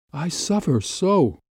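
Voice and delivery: slow, high cry of suffering; anguished lament. A narrator's reading. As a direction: pour out the pain, drawn out. Anguished lover pours out her feelings.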